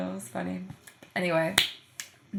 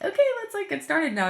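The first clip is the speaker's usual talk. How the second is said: bright, much higher push to get going; a suddenly high, louder teacher's let's-go; eager restart; 11 semitones higher, 5 dB louder than usual. Retold or imitated. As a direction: jump in high and keen to get started. Teacher urging a stalling student.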